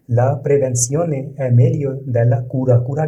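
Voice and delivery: very slow, each word laid out for learners; slow model reading. Shown as a demonstration. Teacher reading the Italian example to learners, slow and careful. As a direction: read it slowly so students can repeat. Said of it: "teacher models Italian sentence".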